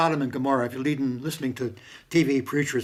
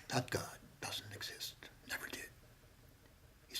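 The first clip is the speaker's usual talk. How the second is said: much softer, hushed whisper; 20 dB quieter than usual. Real, not acted. Denying the TV preachers' God in a whisper. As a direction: drop to a secret-telling whisper.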